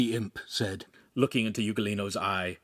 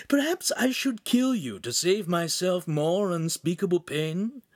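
high, pondering threat; pompous minion toying with his victim; theatrical demon imp; 8 semitones higher than usual. Acted. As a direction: muse menacingly with mock gravity.

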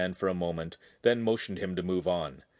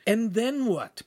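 challenging; 13 semitones higher, 3 dB louder, slower than usual. A narrator's reading. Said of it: "Pan challenged".

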